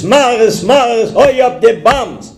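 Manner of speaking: high, urgent cry to her husband; hammed-up frantic wife; panicked calling. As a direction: call up the stairs in alarm. Wife calls up amid bombs.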